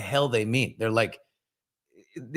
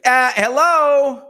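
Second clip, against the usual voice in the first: slow, loud, high mock indignant hello; drawn-out pointed hello; youngest daughter; 12 semitones higher, 8 dB louder, much slower than usual. Youngest daughter wanting in, slow loud mock indignation. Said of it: "the little one on the end is like".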